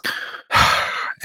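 long breathy sigh of dismay; defeated sigh. Retold or imitated. Traveler told he missed the flight, defeated sigh. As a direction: let out a heavy sigh.

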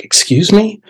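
high, questioning, taken aback; high-pitched confused question; puzzled classmate. Retold or imitated. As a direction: imitate a baffled listener asking back. Classmate reacts to puzzling statement.

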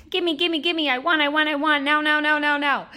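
put-on high, loud whiny toddler chant; a toddler in your brain demanding it now. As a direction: beg for candy like a greedy toddler. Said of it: "it's just like a toddler in your brain".

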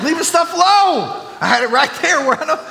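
high, loud comic complaining rant. Pretend. Preacher rants to church about his missing comb, high and loud. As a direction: whine about the stolen comb at full volume.

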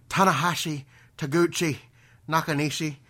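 imitated Irish wrestler listing his rivals; Irish accent. Wrestler listing people he'll beat up, imitated Irish accent. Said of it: "anybody has ever said in an Irish accent".

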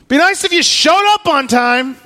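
loud, high, biting sarcasm; sarcastic jab. Retold or imitated. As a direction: needle God for being late. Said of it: "wanted to yell at God like that".

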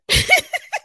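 high, quick staccato laugh; self-mocking laugh. Real, not acted. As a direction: laugh at your own confession. Laughs at admitting she cried.

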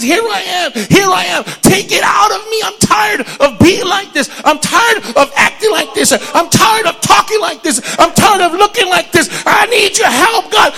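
loud, high preacher shout building to a peak; shouted sermon climax; full-force preaching. Acted. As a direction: thunder it at the congregation.

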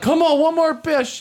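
high, slow mock doctor urging a push. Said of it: "the doctor's now like".

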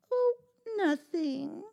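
high, slow, falsely innocent; innocent denial. Acted. Cat covering up her greedy song, innocent. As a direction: play it sweet and innocent. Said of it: "said the cat".

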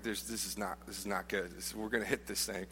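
hushed, uneasy aside to a friend; worried murmur. Retold or imitated. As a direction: keep it low so the captain can't hear.

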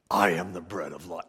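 very deep put-on voice; mock grandiose proclamation. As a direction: intone it like a self-important maniac. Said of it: "who would ever talk like that".

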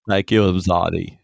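light Troi voice, soft and low; a hint of Counselor Troi; Counselor Troi impression. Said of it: "that's a pretty good Troy".

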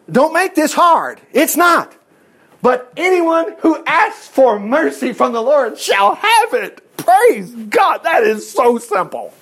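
high, slow, emphatic proclamation; full-force preaching. Real, not acted. Preacher telling the church mercy is simple, full-force preaching. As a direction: preach it high, stretching each phrase.

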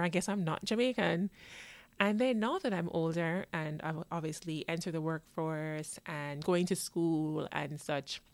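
usual speaking voice

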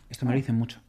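usual speaking voice

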